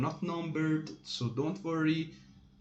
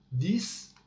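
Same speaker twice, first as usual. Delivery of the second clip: ordinary delivery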